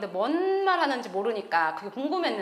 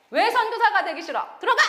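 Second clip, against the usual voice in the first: pastor scolding; 8 semitones higher, 4 dB louder than usual. Retold or imitated. Pastor scolds her, hits head.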